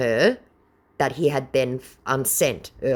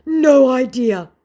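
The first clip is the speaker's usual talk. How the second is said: high, loud dopey scammer; mock scammer voice; 11 semitones higher, 8 dB louder, slower than usual. Vlogger mocking the scammer, high and slow. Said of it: "he responds".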